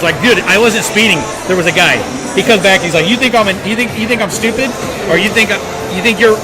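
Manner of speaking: ordinary talk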